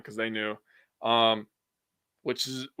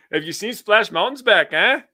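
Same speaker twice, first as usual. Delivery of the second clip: put-on high, louder jokey Canadian accent ending on eh; mock Canadian accent; 8 semitones higher, 5 dB louder than usual. Playing along with the co-host's bit, mock Canadian accent. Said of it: "are you guys Canadian".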